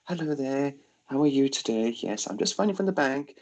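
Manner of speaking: bright, polite phone voice; sweet-talking call-centre lady; polite bank caller. Retold or imitated. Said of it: "it was a nice, polite, you know, local voice on the phone".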